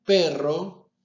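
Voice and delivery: perro with a closed, normal E; demo word. Shown as a demonstration. Teacher demonstrates the closed E in perro. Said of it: "si yo digo".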